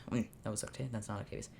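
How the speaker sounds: muttered aside. Real, not acted. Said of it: "self-correcting aside".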